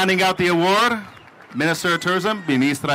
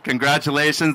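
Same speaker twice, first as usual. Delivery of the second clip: normal talking voice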